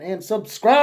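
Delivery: "and subscribe" punched high and loud; emphatic plug on "subscribe"; stress on subscribe. Shown as a demonstration. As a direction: lean hard on "subscribe" to sell it. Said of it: "put a lot of emphasis on that word".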